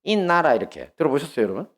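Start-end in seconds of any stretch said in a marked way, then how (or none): 0.0-0.5 quick Gyeongsang-dialect 있나라, higher and louder; 경상도 사투리로 있나라. Shown as a demonstration. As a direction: clip 있나라 short like a Gyeongsang mother waking her kids. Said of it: "경상도 사투리로".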